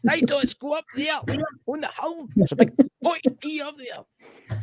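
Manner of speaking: high, far-off calling voice; sing-song call up the hill. Pretend.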